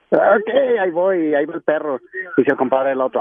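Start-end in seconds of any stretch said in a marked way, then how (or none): 0.1-1.3 talking dog voice. Acted. Dog answers in joke.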